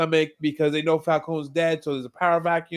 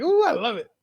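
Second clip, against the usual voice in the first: delighted squeal; 10 semitones higher than usual. Retold or imitated. Own delighted reaction retold.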